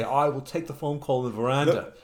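mock patrician accent; Tom Kean. Governor answering his butler on the croquet green, mock patrician. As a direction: answer in a lordly blue-blood accent. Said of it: "And Tom Kean would say".

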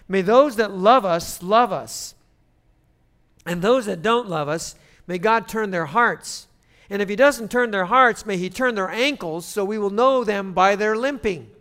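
loud, measured delivery of a blessing; blessing recitation. Acted. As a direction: proclaim a witty blessing to a crowd. Recites Irish blessing to congregation.

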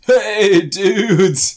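high, loud, drawn-out surfer call; hammed-up stoked surfer greeting; surfer dude. Grown son as a surfer hailing everyone, mock surfer shout. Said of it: "is a surfer".

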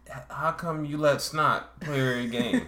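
imitation slow-witted guy asking a question; dumb voice. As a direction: drag the question out in a put-on stupid voice. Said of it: "his voice sounds dumb".

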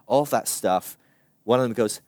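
normal talking voice

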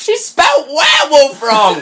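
high, loud laughing shout. Real, not acted. Host spotting the typo, laughing shout.

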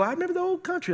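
high-pitched, lightly mocked old-timer voice. Pastor imitating longtime residents of a country town, high-pitched old-timer.

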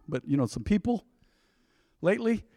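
ordinary talk, nothing marked

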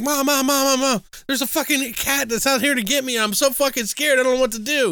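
high, frantic mock-terrified pleading; panicked son. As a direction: squeal it like a scared kid running to mom. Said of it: "he shows up and he's like".

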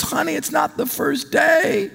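mom calling out. Retold or imitated. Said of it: "She goes".

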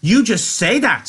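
high, slow, sharp retort; high-pitched sharp scolding. A narrator's reading. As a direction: cut him off in a high, scolding voice. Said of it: "she said sharply".

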